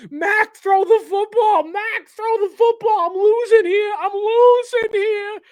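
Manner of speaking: high, slow, frantic New York yell; a panicked lineman begging in New York accent. Retold or imitated. Lineman pleading with quarterback Mac, high strained New York accent. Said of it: "I went all New York on you".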